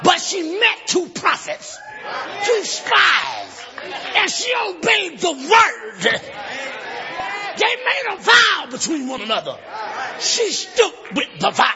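forceful shouted sermon with rhythmic pauses; full-force preaching. Acted. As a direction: declaim the story to the congregation.

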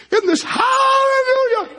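high, slow, crying-out joyful shout; ecstatic shout. Acted. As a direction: let it burst out like a revival cry. Eaglet shouts hallelujah in joy.

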